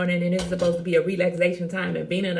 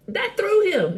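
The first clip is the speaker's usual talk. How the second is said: gleeful; 12 semitones higher, 5 dB louder, slower than usual. Real, not acted. Triumphant punchline.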